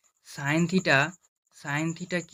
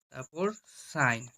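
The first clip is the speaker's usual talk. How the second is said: ordinary talk, nothing marked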